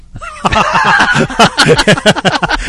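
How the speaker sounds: hearty laugh. Real, not acted. Host laughing at his own warranty joke, big laugh. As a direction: laugh out loud at the punchline.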